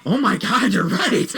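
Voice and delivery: high, delighted gasp of recognition; light up with sudden realization; mock realization. Answering friend in study hall, mock excited. Realizing teacher looks like Thanos.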